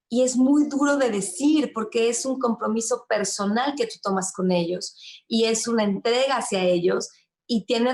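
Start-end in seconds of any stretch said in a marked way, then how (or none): none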